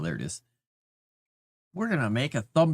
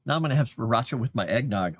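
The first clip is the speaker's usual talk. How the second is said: ordinary talk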